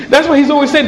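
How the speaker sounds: usual speaking voice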